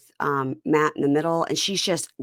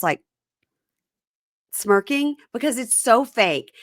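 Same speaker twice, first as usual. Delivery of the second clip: ordinary talk, nothing marked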